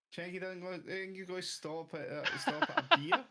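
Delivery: squeaky high mock question. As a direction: ham up a high voice. Mocking incredulous question.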